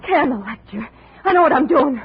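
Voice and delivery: loud, sharp retort; angry rebuff. Acted. Andrea loudly cuts off the detective. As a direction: cut him off, loudly.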